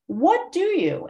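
high, drawn-out "What do you", pitch falling at the end; questioning inflection. Shown as a demonstration. Yoga teacher asking students to notice, questioning inflection. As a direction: lift "What" high and stretch it slowly. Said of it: "your voice is a tool".

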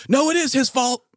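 high mock shout of protest; mock yell. Imagining yelling back at the dog owner, a high mock shout. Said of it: "You can't just yell".

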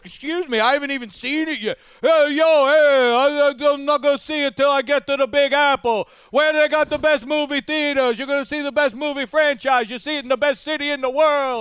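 high, loud New York accent imitating a coworker; hammed-up New York guy bragging about the Big Apple; mock New York accent. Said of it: "make fun of accents".

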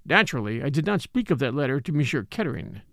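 ordinary delivery

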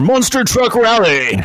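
high, hyped rally announcer; monster truck ad. Pretend. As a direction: bellow it like a truck rally promo.

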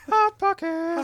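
high, slow and loud; goofy high voice. Pretend. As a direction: sing it out like a jingle. Silly chant of Hot Pockets.

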